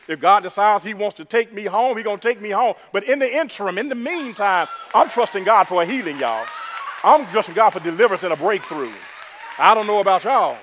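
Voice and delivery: loud, fervent declaration of faith; full-force preaching. Real, not acted. Preacher declaring trust in God for healing, forceful. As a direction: proclaim it with rising force.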